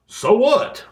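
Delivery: high, loud dismissive scoff; so what, loud and scornful; scornful retort. Real, not acted. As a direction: throw it back in their face.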